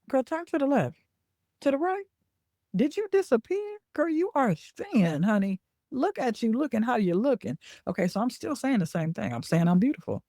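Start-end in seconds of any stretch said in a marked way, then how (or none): 0.1-7.6 high, sassy hype-friend voice cheering herself on; hype girl energy. Shown as a demonstration. To herself in the mirror at morning, high sassy hype. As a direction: pump yourself up like your biggest fan in the mirror. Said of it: "I'm saying it with a different energy".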